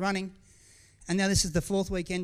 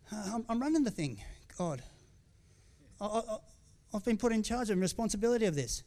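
higher, halting, hurt protest; 4 semitones higher than usual. Retold or imitated. Preacher protesting to God he was put in charge, hurt. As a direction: plead your case to God, faltering.

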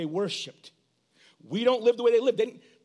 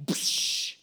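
dismissive scoff. Retold or imitated. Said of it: "I literally was like".

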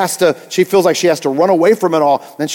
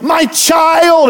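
high, louder-than-life complaint; mock exasperation; stressed commercial lady; 10 semitones higher, 3 dB louder than usual. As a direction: wail it like a frazzled woman in an ad. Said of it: "She was so stressed".

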